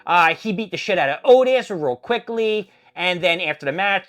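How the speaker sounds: ordinary talk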